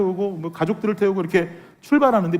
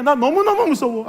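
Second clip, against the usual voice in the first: put-on higher, pleading child's 너무너무 무서워; scared child; 6 semitones higher than usual. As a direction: whine 너무너무 like a frightened little girl. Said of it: "막 그러면서 계속 우는 거예요". (무서워서 우는 아이 흉내)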